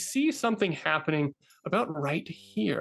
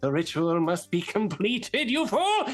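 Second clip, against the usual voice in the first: lightly voiced fast ranting villain; ranting goblin wizard; 4 semitones higher than usual. Goblin wizard ranting at his minions. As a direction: rant at the fools.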